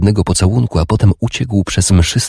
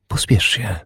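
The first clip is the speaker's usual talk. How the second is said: higher, softer, coaxing; soft woman's call; 5 semitones higher, 6 dB quieter than usual. A narrator's reading. Urdis calling her lover to bed, soft and higher. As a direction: call him softly from the bed.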